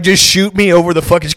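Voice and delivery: normal talking voice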